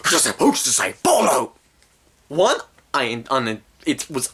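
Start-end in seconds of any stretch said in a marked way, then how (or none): 0.1-1.5 high, strained mock scream. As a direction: scream it as the possessed father. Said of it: "He screams out".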